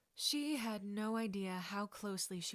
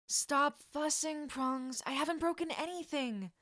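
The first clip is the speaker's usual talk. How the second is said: higher, louder brushing-off; dismissive protest; 6 semitones higher, 5 dB louder than usual. Acted. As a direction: wave off the fussing friend. Hurt friend brushing off help.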